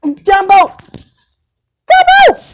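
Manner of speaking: high calling shout, climbing each time. Acted. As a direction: yell the name across the house. Calling out for Dumbo.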